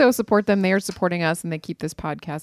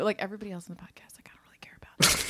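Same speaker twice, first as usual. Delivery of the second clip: whisper; 8 dB quieter, faster than usual. Real, not acted. Confiding aside about other guests.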